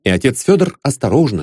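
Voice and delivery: usual speaking voice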